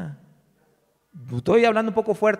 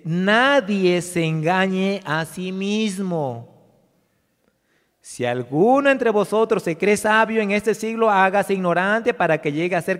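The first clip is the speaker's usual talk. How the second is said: solemn scripture reading; 5 dB louder than usual. Real, not acted. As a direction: proclaim the verse to the congregation.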